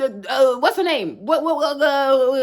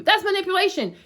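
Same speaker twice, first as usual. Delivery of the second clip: ordinary talk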